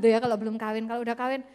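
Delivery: ordinary delivery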